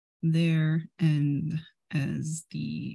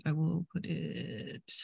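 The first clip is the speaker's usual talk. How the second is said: whispering; 2 semitones lower, 6 dB quieter than usual. Real, not acted. Whispered aside while placing spell.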